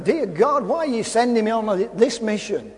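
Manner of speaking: usual speaking voice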